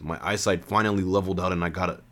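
plain reading voice

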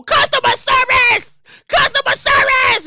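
high, loud, drawn-out yell; screaming for help. Retold or imitated. Angry customer screaming into the phone for service. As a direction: shout down the line for an agent. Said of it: "you are on the phone screaming".

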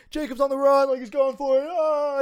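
high, loud imitation of a frantic alarm; mock panicked yell. Counselor radioing that the boy is on the run, mock panicked yell.

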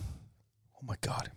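whisper. Real, not acted. Shocked aside.

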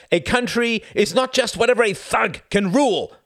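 high, loud, thundering declaration; a president thundering in outrage. Acted. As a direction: thunder it at the diplomat. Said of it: "thundered Bennis".

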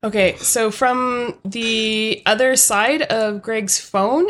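ordinary talk

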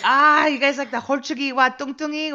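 high, loud burst of realization; excited recognition. Retold or imitated. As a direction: imitate someone delightedly making the connection. Acts out her delighted recognition.

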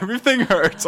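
high whine through laughter; laughing mock whine. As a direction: whimper it while cracking up. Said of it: "He's like".

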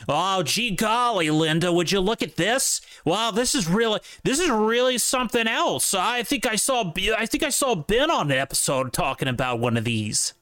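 high-pitched, gushing mock Yankee tourist; generic Yankee accent. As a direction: put on an exaggerated Yankee accent, pitched well up. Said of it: "That's just a generic Yankee impression".

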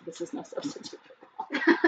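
slogan whispered through giggles; breathless laughing reread of the slogan. Shown as a demonstration. Host rereads snack slogan to co-host, laughing.

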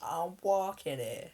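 put-on playful American catchphrase accent; mock American accent. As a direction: stretch it out in a cartoonish American accent. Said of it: "more of an American thing".

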